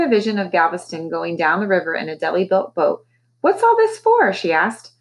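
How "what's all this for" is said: high, loud startled question; a mother asking sharply; mother asking what the tools are for; suspicious question. A narrator's reading.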